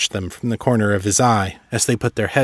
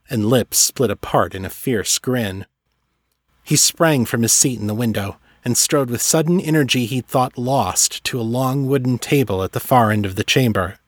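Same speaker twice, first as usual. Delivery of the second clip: ordinary delivery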